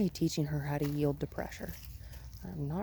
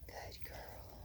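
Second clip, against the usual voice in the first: whispered praise; 19 dB quieter than usual. Real, not acted. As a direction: breathe the praise to calm the dog. Soothing the dog in whispers.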